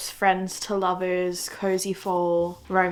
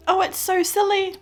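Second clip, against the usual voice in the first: teary; 10 semitones higher, 6 dB louder than usual. Real, not acted. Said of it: "I'm tearing up".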